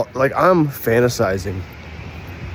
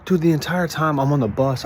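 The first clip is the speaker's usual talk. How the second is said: ordinary talk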